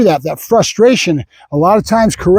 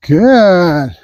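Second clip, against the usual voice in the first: long, stretched-out praise word; duration marker. Real, not acted. Trainer telling dog to keep waiting, drawn-out marker. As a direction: hold the word out slowly, letting it fall. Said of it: "The good duration marker".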